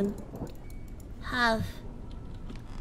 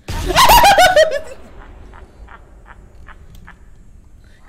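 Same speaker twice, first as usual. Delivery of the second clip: shrieking, hysterical laugh-scream; real jump-scare scream-laugh; 14 semitones higher, 14 dB louder than usual. Real, not acted.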